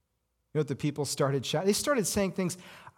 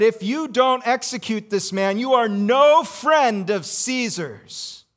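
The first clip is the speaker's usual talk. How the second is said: raised, slow threat from the crowd; mock accusing shout; 6 semitones higher, 5 dB louder, slower than usual. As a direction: spell out the threat, loud and slow. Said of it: "They said".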